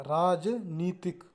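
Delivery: राजनीतिक said slowly with long नी; teacher stretching राजनीतिक; slow careful demo. Shown as a demonstration. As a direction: dictate राजनीतिक syllable by syllable. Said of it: "राजनीतिक ऐसे लिखा जाता है".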